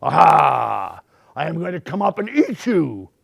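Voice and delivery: loud gloating "Aha!", then a lively threat; lightly voiced menacing troll. A narrator's reading. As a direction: pounce like a storybook troll. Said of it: "said the troll".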